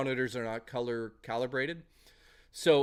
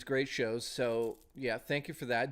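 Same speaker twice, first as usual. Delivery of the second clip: normal talking voice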